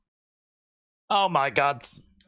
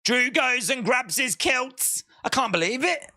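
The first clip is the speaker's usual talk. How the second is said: ordinary talk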